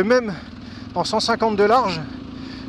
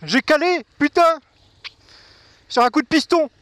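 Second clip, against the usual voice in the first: annoyed outburst; 6 semitones higher than usual. Real, not acted. Engine stalled, annoyed.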